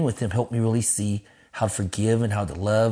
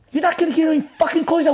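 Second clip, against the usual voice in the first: high, fast, heated outburst; angry yelling; 4 dB louder, faster than usual. Retold or imitated. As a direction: act out a friend blowing up at you. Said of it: "He's like, yelling at me".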